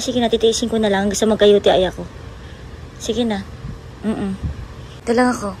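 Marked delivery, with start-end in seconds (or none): none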